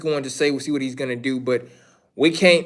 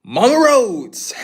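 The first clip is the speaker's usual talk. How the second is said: slow, laughing through the name; 5 semitones higher, slower than usual. Real, not acted. Laughing at the Mama Rhodes bit, slow and amused. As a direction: crack up while saying it.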